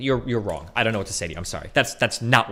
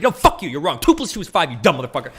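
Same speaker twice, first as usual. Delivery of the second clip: louder and higher, hammed-up fury; mock angry retort; 5 semitones higher, 5 dB louder than usual. Streamer acting his contrarian self in a debate, hammed-up angry shout. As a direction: spit it back like a stubborn contrarian.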